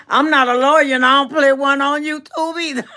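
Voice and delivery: high, loud joke breaking into laughter; amused outburst ending in a big laugh. Real, not acted. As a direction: crack yourself up as you finish.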